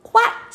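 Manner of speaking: very high, loud quack; duck quack. A narrator's reading. Duck squeezing into the crowd, loud quack.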